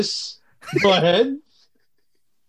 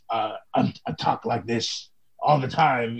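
lightly gruff dragonborn, lower; a hint of a feral growl, pitched down; 4 semitones lower, 5 dB quieter than usual. Shown as a demonstration. Player demonstrating his dragonborn character, lightly gruff voice. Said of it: "like, a gruff ... really feral voice".

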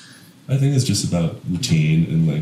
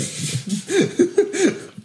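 high laugh. Real, not acted. Laughs at own claim.

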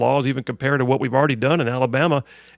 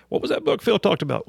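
higher-pitched imitation of a puzzled listener; lightly acted, high questioning voice; imitated curious listener; 7 semitones higher than usual. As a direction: put on a lifted voice, as a listener wondering aloud.

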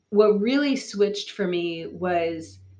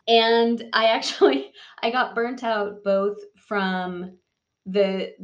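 ordinary talk, nothing marked

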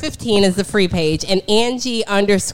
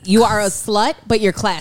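ordinary talk

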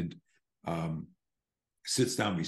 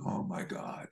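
whispered dread; 8 dB quieter, slower than usual. Retold or imitated. Dreading a chatty seatmate.

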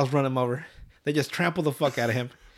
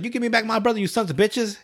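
higher-pitched mock yell, not full volume; half-yell it, pitched up; imitated screaming; 7 semitones higher than usual. Retold Kyle yells at the aliens, mild mock scream. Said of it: "when he's screaming in Cartman's ear".

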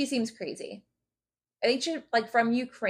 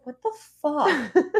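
higher, slow mock disbelief; mock bewilderment; 6 semitones higher, 4 dB louder, slower than usual. Podcaster imitates man finding her in his bed, mock baffled. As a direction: act out a baffled man, drawn out.

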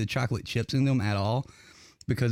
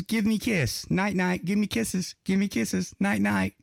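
higher, sing-song talking bird voice; chirpy little parrot chant; parrot impression; 6 semitones higher than usual. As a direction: mimic a pet bird begging for kisses. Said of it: "Scooby goes".

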